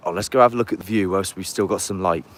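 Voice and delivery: ordinary talk, nothing marked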